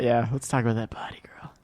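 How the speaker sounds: breathy seductive whisper. Pretend. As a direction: whisper it creepily. Mock seductive line.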